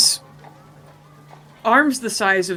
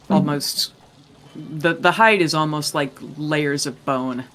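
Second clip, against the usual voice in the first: normal talking voice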